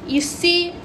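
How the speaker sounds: put-on a boy proudly telling the old gardener he can walk; triumphant. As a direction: show them you can walk at last. Colin triumphant, walking at last.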